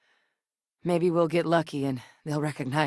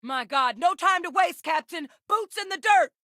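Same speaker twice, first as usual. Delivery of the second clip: higher and higher, urgent rallying cry; urgent battle call; 13 semitones higher than usual. Acted. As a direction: call the captain into the fight. Rallying the captain to fight.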